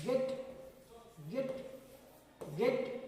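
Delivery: गेट short, weight on ग; गेट with a firm G and brief E; force on the G. Shown as a demonstration. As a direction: say गेट hitting the G, not the vowel. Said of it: "ग का आवाज निकालना है".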